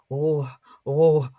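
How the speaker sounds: put-on loud fretful old woman cries; old woman fretting. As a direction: wobble and fret like an old woman. Old woman wobbling with bottle.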